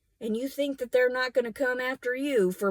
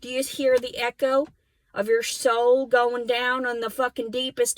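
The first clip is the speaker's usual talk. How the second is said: usual speaking voice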